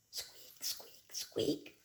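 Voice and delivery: whispered tiny squeaks; tiny mouse squeak. Acted. The mouse answering the cat, tiny whispered squeaks.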